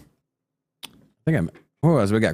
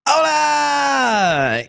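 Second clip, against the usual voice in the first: excited shout; 10 semitones higher than usual. Acted. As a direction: belt it like a hype man. Energetic show greeting.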